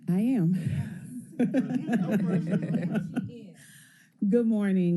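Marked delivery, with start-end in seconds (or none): 0.6-3.5 short breathy laugh at the mic; a quick self-conscious laugh; light laugh. Real, not acted. Teacher greeted at the podium.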